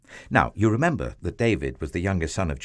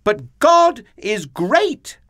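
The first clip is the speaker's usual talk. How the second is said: put-on slow, high, loud boy's declaration; David shouting up; 12 semitones higher, 7 dB louder, slower than usual. A narrator's reading. Small boy defies the giant, high slow shout. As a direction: proclaim it upwards in a boy's shout. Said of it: "David shouted up to him".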